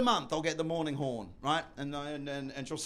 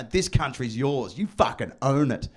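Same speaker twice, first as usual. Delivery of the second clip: mock posh elder; 8 semitones lower than usual. Posh elders telling a newborn.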